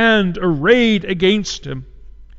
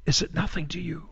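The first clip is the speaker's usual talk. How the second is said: much softer, broken-off repeat of the cry; hushed plea; 11 dB quieter than usual. Acted. Widow Jerusalem asking the passersby again, hushed and softer. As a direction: let the plea fall to almost nothing.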